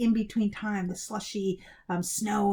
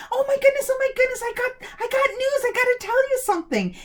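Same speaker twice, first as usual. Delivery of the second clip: very high, loud excited gabble; 5 dB louder than usual. A narrator's reading. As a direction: burst in bubbling with news. Boy rushing home with news.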